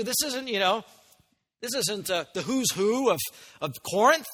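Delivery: ordinary talk, nothing marked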